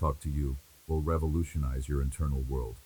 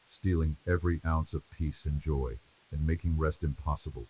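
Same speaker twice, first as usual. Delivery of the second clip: ordinary talk, nothing marked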